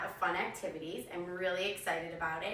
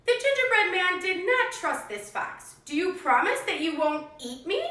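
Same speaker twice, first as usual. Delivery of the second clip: put-on lively read-aloud for young kids; animated picture-book narration; storybook reading; 4 semitones higher, 5 dB louder than usual. A narrator's reading. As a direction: tell it like a classroom story time. Teacher reads story to class.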